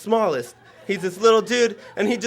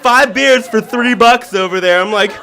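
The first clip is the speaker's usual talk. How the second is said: normal talking voice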